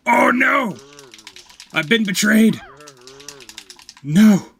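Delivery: loud, high cries of betrayal; dismayed yelling. Real, not acted. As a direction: wail loudly as if betrayed. Cries out at game mishap.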